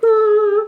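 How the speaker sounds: high, loud, held "ah"; self-mocking, high-pitched gushing wail; mock fangirl squeal. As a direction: squeal like you've gone stupid over someone. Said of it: "I get stupid. I'm just like".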